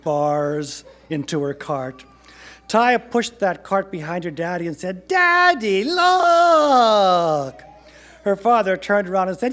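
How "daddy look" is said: high, loud, drawn-out call to dad; excited child's shout. A narrator's reading. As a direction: yell like an excited kid, stretching it out. Child calls for father's attention.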